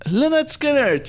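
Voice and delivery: finally remembers the band, elated; excited recall. Real, not acted.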